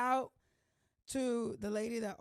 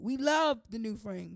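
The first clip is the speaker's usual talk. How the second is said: "love" stretched slowly and louder; stress on love; 7 dB louder than usual. Real, not acted. Vlogger correcting herself, "love" slow and loud. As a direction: lean hard on "love". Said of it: "we don't like it".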